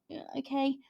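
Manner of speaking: high, soft sceptical concession; softly humouring a dubious claim; doubtful. Retold or imitated. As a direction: go along with it unconvinced, voice lifted and small. Doubtful reaction to book's claim.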